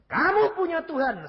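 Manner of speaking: loud, high preacher's challenge; confronting shout. Retold or imitated. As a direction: throw it at the killer at full voice. Retells confronting the killer. (seruan lantang menghardik)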